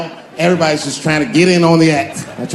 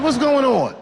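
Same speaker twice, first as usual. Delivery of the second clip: high, anxious question; alarmed father; 10 semitones higher, slower than usual. Acted. Dad answering his son's late-night call, alarmed. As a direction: ask it in rising mock alarm.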